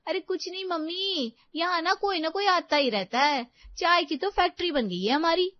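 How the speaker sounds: high, loud complaining to mom; high-pitched daughter-in-law meant to be overheard; loud phone talk. A narrator's reading. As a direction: gripe loudly down the phone in a high voice. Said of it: "जोर-जोर से बातें करने लगती है".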